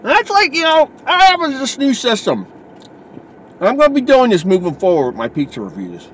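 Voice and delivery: chewing between words; talking while chewing. Real, not acted. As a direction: mouth partly full of food. Seen 2: Holds bitten slice, mouth working on food while speaking.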